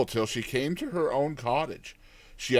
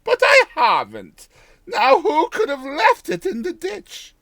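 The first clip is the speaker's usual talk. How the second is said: put-on high, loud old village woman; old countrywoman; 7 dB louder than usual. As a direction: voice her as a chatty old peasant woman, higher and louder. Old woman musing over a pot.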